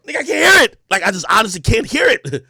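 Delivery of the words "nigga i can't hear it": high, loud exasperated cry; exasperated outburst. Retold or imitated. Host re-enacting not hearing [name], loud exasperation. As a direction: blurt it out in frustration. Acting out failing to hear [name].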